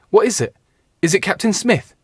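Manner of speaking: high, loud, anxious questions; alarmed question. Acted. Richard asking the breathless page about Smith, high and alarmed. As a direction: fear the worst about the captain.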